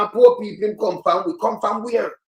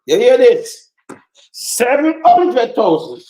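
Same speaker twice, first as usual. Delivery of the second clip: loud and higher, slowly dragging out the number; outraged shout; 4 semitones higher, 8 dB louder, slower than usual. Real, not acted. Bystander shouting the sum to the crowd, slow outraged yell.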